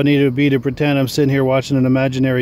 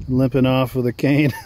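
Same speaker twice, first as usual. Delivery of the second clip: ordinary delivery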